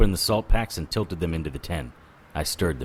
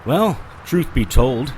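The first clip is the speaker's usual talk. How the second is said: usual speaking voice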